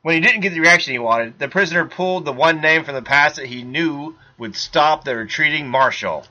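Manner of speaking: ordinary talk, nothing marked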